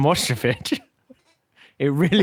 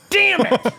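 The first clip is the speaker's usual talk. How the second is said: sudden high, loud cry of annoyance at himself; frustrated outburst; 6 dB louder than usual. Real, not acted. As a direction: blurt it out, cross at the slip. Flubs the requested clean take.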